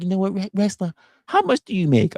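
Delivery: high put-on voice of a woman asking; imitating the woman. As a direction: mimic her question, pitched well up. Voicing woman walking up asking.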